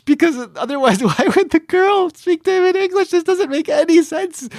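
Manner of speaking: very high, incredulous squeak; exasperated rant. Real, not acted. Podcaster baffled why the girl would speak English, high exasperated protest. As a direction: pitch shoots up in baffled protest.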